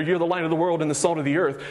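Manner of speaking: fast, tossed-off line; flippant. Shown as a demonstration. Pastor shows Jesus NOT saying it flippantly. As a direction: throw it away like it means nothing. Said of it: "flippantly throwing this out there".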